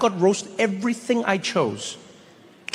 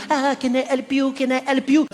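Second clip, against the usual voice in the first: a lightly higher, overeager clerk; mock the hovering salesperson, lightly; a French woman mimics pushy American shop staff, lightly eager; 6 semitones higher than usual. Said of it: "they are all on top of you".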